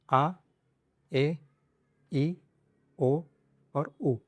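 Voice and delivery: each Spanish vowel said alone, clear and drawn out; vowel demo. Shown as a demonstration. Teacher to class, sounding out vowels clearly. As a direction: sound out आ, ए, ई, ओ, ऊ one at a time. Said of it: "यह है Spanish के vowels".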